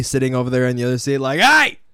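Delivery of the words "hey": angry yell. Retold or imitated. Acted angry yell at diners.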